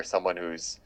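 usual speaking voice

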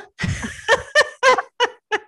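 very high-pitched imitated laugh; high staccato mock laughter. Imitating friend laughing at her photography plan, mocking.

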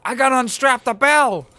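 high, loud crowd-member shouts; excited cheering townsfolk. Retold or imitated. Host imitates ninety townsfolk at the bell, excited shouting. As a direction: yell like a townsperson thrilled beyond reason. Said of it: "cheering, and, like, ninety people".

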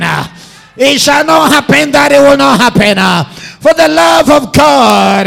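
leading congregation in a shouted confession; shouted declaration. Shown as a demonstration. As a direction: ride the uh breaths like a preacher. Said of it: "Can you come to the place and say".

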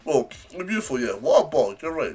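ordinary talk

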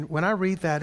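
normal talking voice

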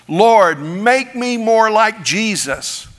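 lifted, slow pleading prayer; earnest prayer. Retold or imitated. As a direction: pray it like a devout believer reaching upward. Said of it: "let me give you an example".

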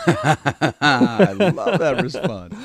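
laughing through the words, loud and slow; can't stop laughing while saying it. Real, not acted. Cohost cracking up at the old lady's answer, laughing loudly.

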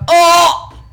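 high, loud horrified "oh no"; shocked cry. Retold or imitated. Teacher reliving her horror in the classroom toilet, loud yelp.

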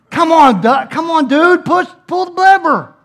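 high, shouted urging; imitated exasperated kid. Kid yelling at the frozen rider, high loud impatient imitation. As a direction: yell at him like a frantic impatient teen. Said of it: "He goes".

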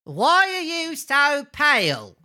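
put-on slow, high comic old man; old man, sudden question. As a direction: ask it in a drawn-out high character voice. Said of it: "the old man asked suddenly".

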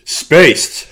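high, loud comic announcement; dramatic intro shout. Acted. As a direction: belt out the title. Intro bit, dramatic opener.

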